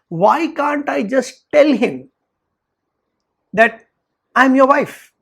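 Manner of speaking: acted-out frustrated wife; exasperated plea. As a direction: plead it slowly, then push the last words. Wife agonises to her friend.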